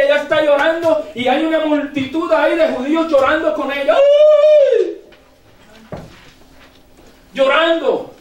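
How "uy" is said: mock wail.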